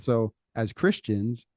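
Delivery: ordinary delivery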